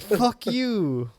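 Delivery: high, drawn-out mock insult; playful exasperated scorn; mock outrage. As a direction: fling a slow, high insult at the character.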